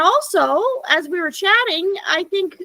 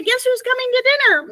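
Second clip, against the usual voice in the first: frog voice; 6 semitones higher, much faster than usual. Retold or imitated. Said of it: "the frog would say".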